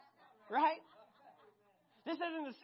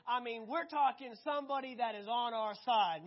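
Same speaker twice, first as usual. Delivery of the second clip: ordinary talk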